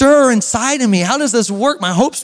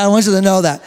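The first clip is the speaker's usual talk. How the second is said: ordinary delivery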